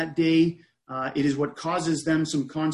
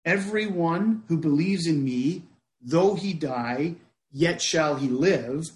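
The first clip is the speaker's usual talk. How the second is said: measured reading. Acted. Repeating the verse slowly.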